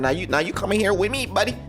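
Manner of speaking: usual speaking voice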